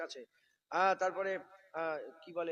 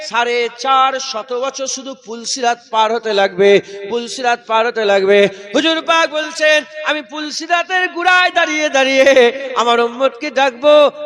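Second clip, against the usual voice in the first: full-force waz; 6 semitones higher, 24 dB louder than usual. Real, not acted. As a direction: chant it to the crowd at the top of your voice.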